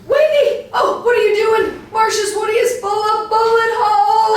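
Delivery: high, loud panicked scolding; shrieking at her friend to stop shooting; horrified shout. Acted.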